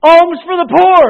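loud pleading call; beggar's call. Acted. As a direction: go back to begging. Beggar repeats his plea.

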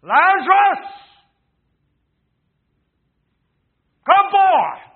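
thunderous high shout with a long pause between calls; roaring, drawn-out command; Jesus. Acted. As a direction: call the dead out at full voice. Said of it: "hear it in Jesus' voice".

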